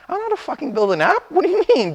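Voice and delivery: fast, high-pitched mock exasperation; exasperated disbelief. To Vic suggesting an app: a fast, high-pitched, baffled protest. Told to just build an app.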